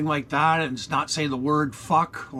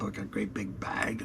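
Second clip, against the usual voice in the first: whisper; 6 semitones lower, 10 dB quieter than usual. Real, not acted. Joking aside about mother's pretzels.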